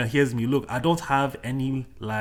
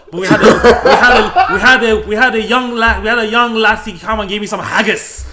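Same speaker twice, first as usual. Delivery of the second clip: put-on Scottish accent; 3 semitones higher, 10 dB louder than usual. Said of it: "please do not flame us in the comments for that accent".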